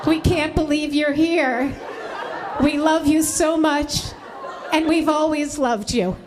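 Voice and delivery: mock tearful gushing, rising in pitch; tearful mother. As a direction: imitate a mother overcome with gratitude. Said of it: "she burst into tears".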